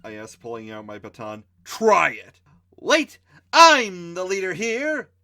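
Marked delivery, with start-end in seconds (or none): 1.6-2.4 defiant dare. Acted. Hero daring Mikey. 2.8-5.1 put-on high, slow, bossy girl voice. Kenda insisting to the group she leads, bossy high voice. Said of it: "Kenda said".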